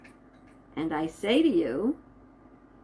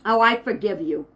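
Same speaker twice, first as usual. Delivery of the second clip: "Oh, I forgive you" said louder with a sour twist; sarcastic forgiveness; 5 dB louder than usual. Shown as a demonstration. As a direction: spit out "I forgive you" like you don't mean it. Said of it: "put a negative twist on 'em".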